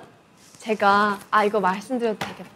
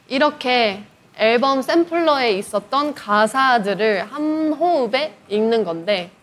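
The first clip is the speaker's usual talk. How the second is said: normal talking voice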